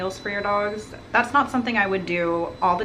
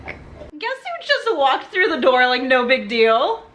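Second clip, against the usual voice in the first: welcoming someone home; 6 semitones higher, 6 dB louder than usual. Real, not acted.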